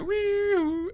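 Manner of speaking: high whooping intercom siren; mock siren whoop. Mimicking the intercom alert before the captain's speech, a high whoop. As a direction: whoop it out like a ship alarm. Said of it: "They just go".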